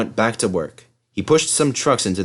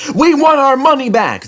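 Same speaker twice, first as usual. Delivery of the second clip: very high, loud angry shout; crowd shout; 6 dB louder than usual. A narrator's reading. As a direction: demand a refund. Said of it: "they shouted".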